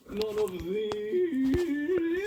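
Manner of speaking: high, very slow astonished; drawn-out hammed disbelief; mock shock. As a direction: stretch it out in fake outrage. Mock shock over no emojis.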